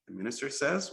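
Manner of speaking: plain reading voice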